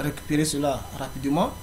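normal talking voice

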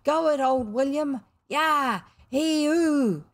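high, loud gang whooping; loyal cheers. A narrator's reading. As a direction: cheer him on like a rowdy boys' army. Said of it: "loyal cheers from his army".

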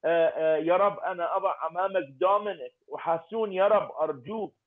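usual speaking voice

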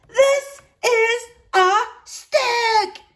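very high, slow loud outburst; outraged shout. A narrator's reading. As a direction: shout in furious disbelief. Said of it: "he shouted".

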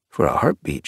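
plain reading voice

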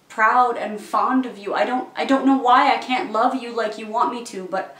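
impassioned. Acted. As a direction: burst out with heartfelt frustration. Pleading while turning down a suitor.

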